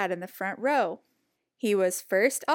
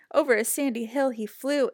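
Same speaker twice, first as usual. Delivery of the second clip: usual speaking voice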